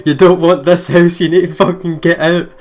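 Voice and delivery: hammed-up ghostly yell; mock scream. Ghost screams at the heroine from behind the mirror, frantic.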